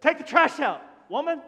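loud, high mock defiance; hammed-up disrespect, voice jumping up; cheeky back-talk. Boys trying that on their moms, loud and cheeky. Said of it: "try that at your mom".

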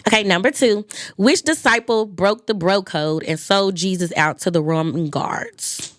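ordinary delivery

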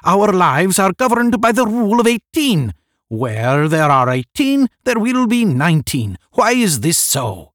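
very high, loud oratory; crying out. A narrator's reading. One questioning the rule of eighteen, crying out. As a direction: declaim it loudly, demanding a reason. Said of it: "one cried".

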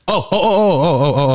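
high, rapid-fire string of ohs; excited blurting. Real, not acted. Co-host hunting for a forgotten word, excited blurting. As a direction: burst in like you just remembered the answer.

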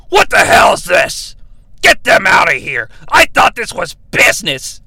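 gruff shouted rebuke; angry shout. Retold or imitated. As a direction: bark them out of the room. Goldberg angrily rejects the women.

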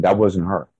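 ordinary delivery

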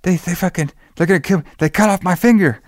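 mock panic, high and rushed; panicked girl. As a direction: imitate her freaking out on the phone. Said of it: "she's, like, freaking out".

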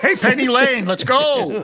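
laughing, exasperated holler up the line; impatient calling out. Pretend. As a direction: play an impatient customer hurrying someone along. Heckling the slow child in line.

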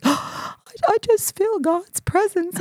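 high, breaking voice of retold crying; reliving the tears, voice cracking; tearful, choked up. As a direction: catch the breath and let the voice crack up high. Retold weeping at Pope's mass.

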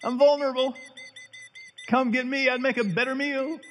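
very high, squeaky bird voice; killdeer impression. Preacher imitating a killdeer for the congregation, very high bird voice. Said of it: "my best killdeer impression".

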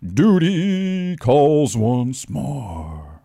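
slow, grand trailer voice; dramatic movie-trailer voice. Retold or imitated. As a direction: read it like an epic trailer line. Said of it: "dramatic music playing behind his tweet".

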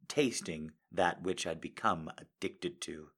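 ordinary delivery, nothing marked

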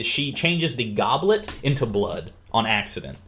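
ordinary talk, nothing marked

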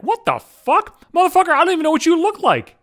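high-pitched, incredulous outburst; baffled indignation. Retold or imitated. Baffled at a coworker's message, indignant high-pitched reaction. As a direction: retell it as a high, exasperated protest.

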